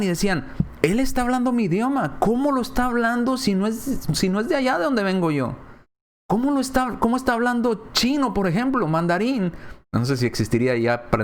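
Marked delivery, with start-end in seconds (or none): 0.8-9.5 amazed hearers. Acted.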